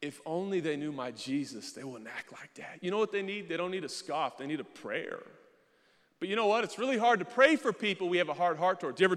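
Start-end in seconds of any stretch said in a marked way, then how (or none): none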